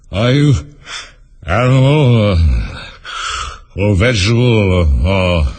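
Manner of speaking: loud yawns swallowing the words; weary lion talking through big yawns; yawning. A narrator's reading. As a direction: yawn on every other word, slowing right down. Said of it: "yawning at every other word".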